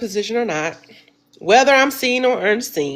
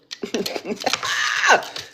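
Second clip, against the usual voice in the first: soft breathy laugh; 5 dB quieter than usual. Real, not acted. Unboxing a gift.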